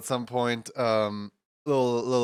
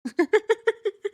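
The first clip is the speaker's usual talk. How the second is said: high burst of real laughter; loud giggling laugh; 5 dB louder than usual. Real, not acted. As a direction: crack up at the punchline. Laughing at own story.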